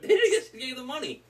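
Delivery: very high-pitched, laughing too hard to finish. Real, not acted. Podcast host losing it over the unpaid money, laughing. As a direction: squeal the words out through laughter. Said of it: "I'm laughing because".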